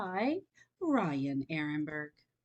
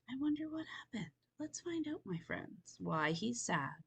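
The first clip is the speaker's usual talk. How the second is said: softer, curious wondering aloud; soft musing; 3 semitones higher, 9 dB quieter than usual. Real, not acted. Reader wondering softly with children about the boy. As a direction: lean in and wonder quietly with them.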